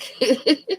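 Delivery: short high giggle; brief, high-pitched chuckle; chuckling. Real, not acted. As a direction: giggle at your own joke. Laughs, says likes that joke.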